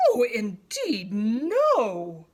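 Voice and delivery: slow, proud scoff. A narrator's reading. Proud giraffe refusing the hornbill, slow scoff. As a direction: look down your nose and refuse. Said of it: "scoffed the proud giraffe".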